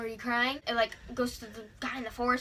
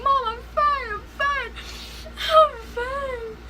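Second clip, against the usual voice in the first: high, loud teary insisting; crying; 13 semitones higher, 7 dB louder, slower than usual. Real, not acted. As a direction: insist through sobs you're fine. Crying, reassuring mom.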